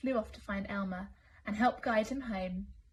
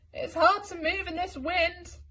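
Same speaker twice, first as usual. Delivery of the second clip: high, loud distant-sounding voice; Wilbur faking Elmer; 8 semitones higher, 5 dB louder than usual. Acted. Wilbur pretending to be Elmer out in the wind. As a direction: throw the voice as if far off. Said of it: "sounding like Elmer".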